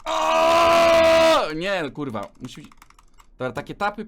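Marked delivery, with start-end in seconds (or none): 0.1-1.5 loud high effort shout; a sudden straining yell; effort grunt. Real, not acted. Effort shout during clicking. (okrzyk wysiłku)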